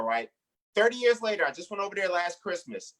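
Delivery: ordinary talk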